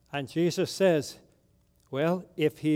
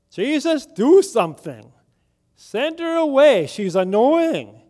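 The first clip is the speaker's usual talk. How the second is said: higher and louder, exasperated complaint; fed-up disciples; 4 semitones higher, 5 dB louder than usual. Retold or imitated. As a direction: whine to Jesus to get rid of her. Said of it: "they've had enough of it".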